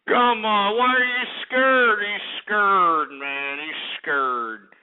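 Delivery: slow jeer leaning long on "scared"; drawn-out goading, sinking at the end; mocking taunt. Real, not acted. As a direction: stretch "scared" out to needle him.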